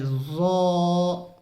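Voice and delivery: ज़ो drawn out slowly, heavy and rounded; full-mouthed zo. Shown as a demonstration. Teacher to viewers, a long heavy ज़ो. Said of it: "हमें पुर करके पढ़ना है".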